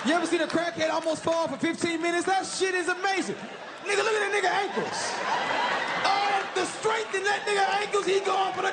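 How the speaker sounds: excited shouting. Retold or imitated. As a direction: cheer it like an Olympic final. Cheering crackhead's balance like sports.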